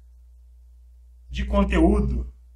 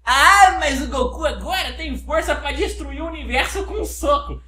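higher, laughing protest; laughing whiny objection; 7 semitones higher, 4 dB louder than usual. Pretend. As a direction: protest while cracking up. Fã insiste, rindo. (protesto rindo, agudo)

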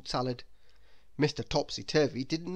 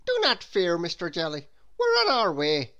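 loud, higher official voice; policeman; 3 semitones higher, 6 dB louder than usual. A narrator's reading. The policeman answers Mister Jelly's call, firm and loud. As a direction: reassure him loudly and firmly as an officer. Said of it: "said the policeman".

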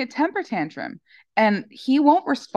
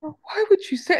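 higher pitched, playing the dog as offended; 8 semitones higher than usual. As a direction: voice the dog, mock hurt. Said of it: "he'll look at you like".

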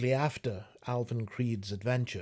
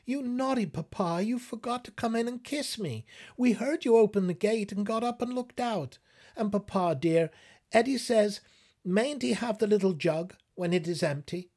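put-on much higher, childlike voice; little daughter; 10 semitones higher, 4 dB louder than usual. Little daughter in her bedgown to papa, high childlike voice. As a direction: chirp it up like a small girl. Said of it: "his little daughter ... saying".

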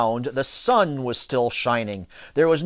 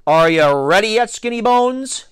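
raised, climbing taunt; taunting yell; 6 semitones higher, 4 dB louder than usual. A narrator's reading. Pitcher heckling his stalling rival, yelling. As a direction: yell it out to needle him. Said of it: "yelled T J".

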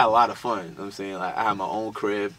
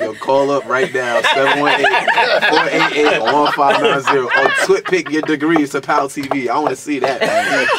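louder, laughing dare to the viewers; laughing call-out; 4 semitones higher, 10 dB louder than usual. Real, not acted. Daring viewers to send their degrees, loud laughing call-out. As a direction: shout the number out laughing.

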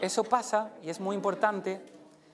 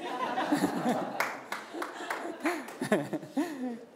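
hearty laugh; 8 semitones higher than usual. Real, not acted. Laughing at late students leaving.

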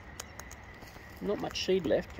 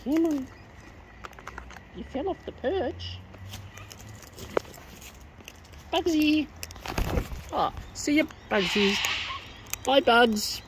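cooing much higher to a pet; 7 semitones higher, 5 dB quieter than usual. Acted. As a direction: coax the bird in a sweet high voice. Cooing to the bird.

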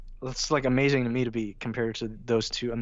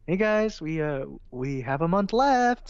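overly upbeat email voice; 6 semitones higher, 3 dB louder, slower than usual. Retold or imitated. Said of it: "I had to email people like".

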